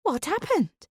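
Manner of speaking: sharp high cry of alarm; high startled exclamation; alarmed exclaim. A narrator's reading. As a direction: gasp it out in shock. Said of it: "she exclaims".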